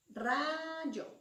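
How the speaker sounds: RAYO with a long, stretched RA, then short yo; stress on RA. Shown as a demonstration. Teacher showing students the tonic syllable, stretched stress on RA. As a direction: draw out and lean on the RA in rayo. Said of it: "Es la sílaba ra".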